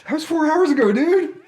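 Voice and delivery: very high, incredulous squeal; mock exasperation. Car passenger answering a stale conversation, high mock exasperation. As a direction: pitch it way up, comically fed up. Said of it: "it's just like".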